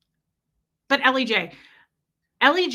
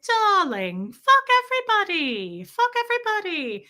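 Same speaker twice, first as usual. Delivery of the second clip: much higher, posh British voice; lilting British darling, high and grand; posh British Ellie J; 10 semitones higher than usual. Retold or imitated. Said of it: "she was like".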